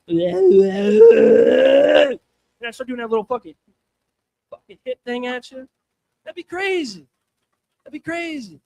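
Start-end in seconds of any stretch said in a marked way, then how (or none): 0.2-2.2 loud high yowling cat sound; cat hiss. Pretend. Comic acting like a cat at a heckler, loud yowl. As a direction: screech like a cornered cat. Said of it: "And I go".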